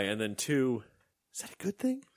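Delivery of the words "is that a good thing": much higher, squeaky, slowing on the thank you; unsure high thanks. Retold or imitated. Man mimics his high reply to the cashier, squeaky and unsure. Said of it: "So I did, like, the".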